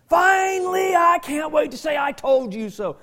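loud gleeful gloat, high and loud. Pretend. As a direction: crow over someone's failure. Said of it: "That's walking in the flesh".

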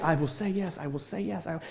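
whisper-like longing to herself; a hint of a waiting bride; softly voiced bride. Bride's eager inner voice.

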